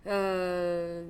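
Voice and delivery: long French "uh" filler; French pause sound. Shown as a demonstration. Showing the sound for pauses, drawn-out French "uh". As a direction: hold "uh" like a French hesitation. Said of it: "the French have a sound for their pauses".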